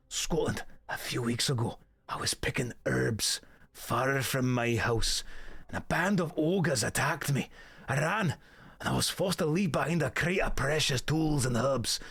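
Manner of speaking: rusty, piratical Scottish storyteller; Scottish accent. Acted. Streamer voicing an old quest-giver, Scottish accent. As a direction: read it out in a put-on Scottish brogue. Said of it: "I've not done Scottish in a while".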